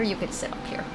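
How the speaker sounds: soft whisper to the puppy. Real, not acted. Owner whispering to her puppy on her lap. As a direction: hush it to the dog.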